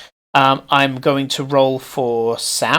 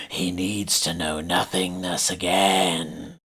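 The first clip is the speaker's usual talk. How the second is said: slow, hollow, lower rasping chant; a possessed thing giving orders; rasping creature voice; 7 semitones lower, 5 dB quieter, slower than usual. Acted. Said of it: "you hear this rasping voice say".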